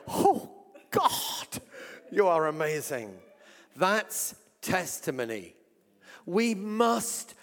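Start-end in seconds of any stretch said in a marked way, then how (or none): 0.1-3.2 amazed praise. Retold or imitated. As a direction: cry out in amazement. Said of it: "I was going".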